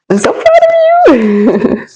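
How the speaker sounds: teary, emotional praise; tearful pride. Real, not acted. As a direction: voice breaking high with tears, slowed. Reader moved while giving reading.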